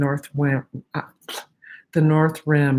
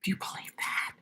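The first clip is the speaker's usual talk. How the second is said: whispering; 15 dB quieter, faster than usual. Real, not acted. Aside to viewers, secretive.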